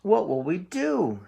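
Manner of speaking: fretful goat voice; nervous middle goat. Acted. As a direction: squeak it out like a nervous little goat. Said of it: "said Middle Billy Goat Gruff".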